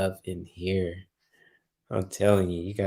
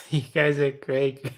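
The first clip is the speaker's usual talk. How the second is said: higher, words shaken by laughter; laughing; 5 semitones higher than usual. Real, not acted. Streamer telling viewers they're crazy, laughing. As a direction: try to speak while giggling.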